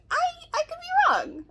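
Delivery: high mock humble voice; sarcastic high. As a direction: squeak it up sarcastically.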